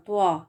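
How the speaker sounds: توا with a rounded w glide; wrong waw reading. Shown as a demonstration. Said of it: "تو یہ غلط ہے". (غلط، واو کے ساتھ توا)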